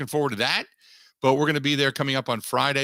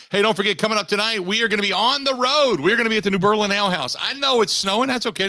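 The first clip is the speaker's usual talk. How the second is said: higher, louder, fast radio plug; upbeat promo read; 7 semitones higher, 4 dB louder than usual. Acted. Host plugging tonight's bar show to listeners, pumped-up promo read. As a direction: sell the live event like an ad.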